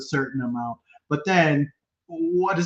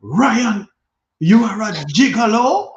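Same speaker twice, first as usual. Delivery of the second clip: high, loud Jamaican mom; mother scolding in a Jamaican accent; 8 semitones higher, 6 dB louder, slower than usual. Retold or imitated. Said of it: "I'm gonna do the Jamaican accent".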